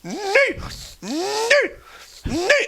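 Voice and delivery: very high squawking cries of Knight; high-pitched repeated yell, imitating a buddy; shrieking bird call. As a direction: screech it like a wild bird. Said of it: "What is that sound?".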